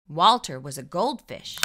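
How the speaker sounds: usual speaking voice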